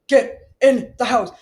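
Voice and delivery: loud, high mock-panicked warning; freaking out. Partner warning husband about petting a skunk, panicked shout. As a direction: yell an urgent warning, slowing on the skunk. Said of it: "you were freaking out".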